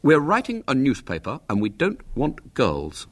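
put-on mildly firm boy's refusal; understated firmness. A narrator's reading. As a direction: shut the girl out firmly. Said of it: "said William firmly".

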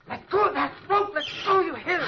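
high, loud, panicked shout; desperate, shrieked command; frantic order. Acted. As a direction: yell at him to let go.

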